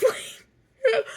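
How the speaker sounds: high-pitched laugh breaking into the word; a real laugh bubbling over; laughing burst. Real, not acted. Laughing mid-story about panic attacks.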